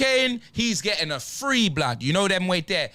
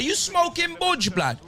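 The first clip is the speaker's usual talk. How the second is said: incredulous scoff; 6 semitones higher than usual. Real, not acted. Mocking manager's post-match claim.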